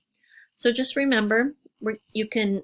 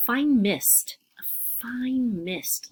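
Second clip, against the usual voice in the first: teacher whispering the hint; 3 semitones higher, much slower than usual. Shown as a demonstration. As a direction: whisper it like a fine mist. Whispering the clue word mist.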